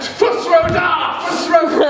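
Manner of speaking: high, slow shouted Fus Ro Dah; dragon shout. Acted. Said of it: "better shout".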